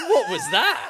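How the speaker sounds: very high, loud squeal through laughter; incredulous laughter. Real, not acted. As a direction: shriek it, cracking up. Cracks up at the bad accent.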